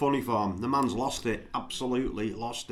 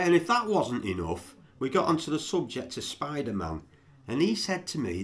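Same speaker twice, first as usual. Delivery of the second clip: usual speaking voice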